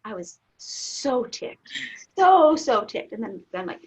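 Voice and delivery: normal talking voice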